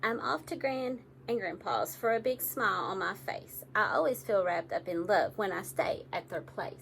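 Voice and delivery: plain reading voice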